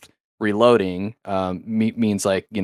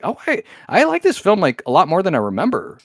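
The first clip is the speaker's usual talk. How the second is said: pleasantly surprised; 7 semitones higher than usual. Retold or imitated. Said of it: "I was like".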